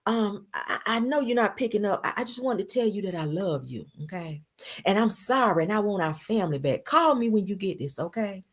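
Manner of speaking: meek, pleading voicemail impression; hammed-up begging ex leaving a message; Apollo. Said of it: "on the phone like this".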